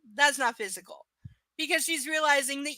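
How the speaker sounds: ordinary talk, nothing marked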